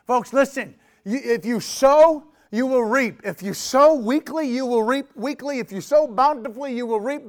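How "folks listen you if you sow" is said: ordinary talk, nothing marked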